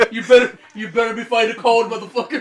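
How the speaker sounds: loud, mocking tough-guy taunt, half-laughing; mock tough-guy yell. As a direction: bellow it like a macho friend teasing a crier. Mocking a crying man loudly.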